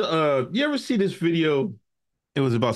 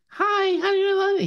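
[name]; slower than usual. Retold or imitated. Showing how the singer greeted people in public, high-pitched. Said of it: "when he got in public".

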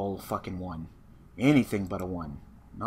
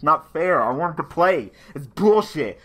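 angry whine; 5 semitones higher, 7 dB louder than usual. Real, not acted. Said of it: "Fucking so angry".

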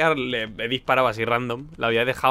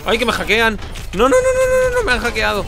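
frantic high yells of no; panicked yelling; 11 semitones higher, 7 dB louder than usual. Real, not acted. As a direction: shriek in real panic. Panics at being hacked.

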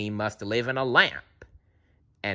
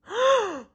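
sharp high gasp; crowd gasp. A narrator's reading. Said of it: "The crowd gasped".

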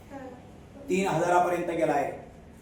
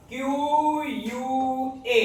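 slow spelling; 6 semitones higher, much slower than usual. Shown as a demonstration. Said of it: "मला एक सांगा".

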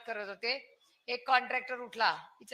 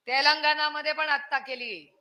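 usual speaking voice

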